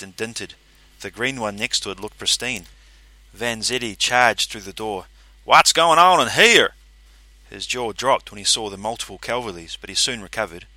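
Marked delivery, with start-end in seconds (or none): 5.4-6.7 loud, high, slow demand. A narrator's reading. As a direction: burst in demanding answers. Vanzetti charges in.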